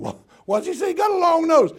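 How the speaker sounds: high, loud puzzled cry; squeaky bewildered outburst; baffled. Retold or imitated. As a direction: exclaim in disbelief, way up high. Puzzled self-quote, far voice.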